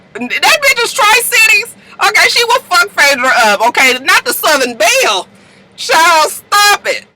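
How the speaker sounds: high-pitched, incredulous telling-off; mock scolding. Vlogger telling off [name] for threatening [name], high mock scolding. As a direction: scoff at the empty threat, pitch flying up.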